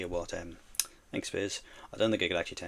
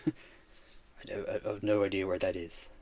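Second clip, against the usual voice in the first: ordinary delivery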